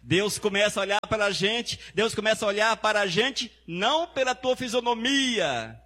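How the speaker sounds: high ringing preacher's cry; preaching at full force. Acted. Pastor calls out to the church, preaching at full force. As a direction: lift the church with the promise.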